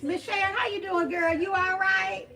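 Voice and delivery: high, lively hail to a friend; enthusiastic greeting. Real, not acted. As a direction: call out a big friendly check-in. Calls out greeting to a viewer.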